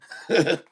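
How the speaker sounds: short chuckle at own joke; real chuckle. Real, not acted. Joke teller enjoys own punchline, real chuckle. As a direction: chuckle after landing the punchline.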